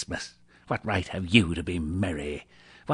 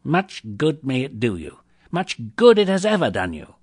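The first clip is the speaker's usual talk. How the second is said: building louder and higher; scornful taunt; 5 semitones higher, 7 dB louder than usual. A narrator's reading. Scrooge mocking his nephew's Christmas, scornful. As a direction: jeer with mounting irritation.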